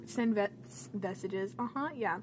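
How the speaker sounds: ordinary talk, nothing marked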